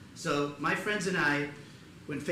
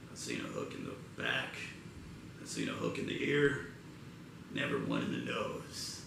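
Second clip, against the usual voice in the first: put-on softer, far deeper old man; old guy on the dock; 6 dB quieter than usual. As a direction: drop deep and draw it out like an old salt. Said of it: "that old guy on the dock".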